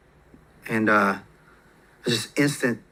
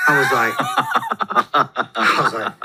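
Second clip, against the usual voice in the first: laughing; 3 dB louder than usual. Real, not acted. Cracking up at memory.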